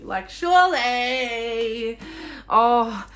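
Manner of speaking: slow, over-the-top protest; exaggerated disbelief. Real, not acted. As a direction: stretch it out in mock incredulity. Mock disbelief at readers who disliked it.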